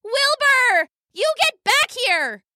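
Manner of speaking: very high-pitched, indignant yell; angry shout. A narrator's reading. Weasel sister calling after fleeing brother, shouting. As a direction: call after him high and cross. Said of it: "Wexy shouted".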